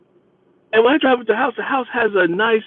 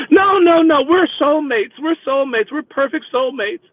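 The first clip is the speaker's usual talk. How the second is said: mock desperate insistence; 8 semitones higher than usual. Passenger insisting to her driver, mock desperate.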